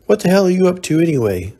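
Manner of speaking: fast, high and loud outrage; angry protest. Acted. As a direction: fire it back at him, furious. Slapped girlfriend protests angrily.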